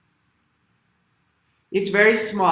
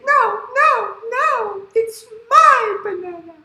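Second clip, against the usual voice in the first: very high, loud squeal; protesting animals; 7 dB louder than usual. Acted. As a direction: squeal back like a chorus of small animals. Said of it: "all the animals, they say".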